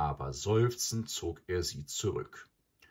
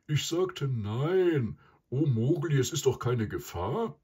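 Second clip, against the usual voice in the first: louder, higher buffalo voice; loud, put-out animal character; grumbling buffalo; 7 semitones higher, 5 dB louder than usual. A narrator's reading. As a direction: grumble emphatically, pitched up. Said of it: "brummte der Büffel".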